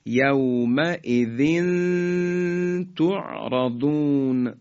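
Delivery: slow recitation of «یومئذ تعرضون», hummed tanwin; measured «یومئذ تعرضون» with a long nasal link between the words; ikhfa. Shown as a demonstration. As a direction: draw out the final n of «یومئذ» into «تعرضون». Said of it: "تنوین رسید به طی دو نقطهٔ".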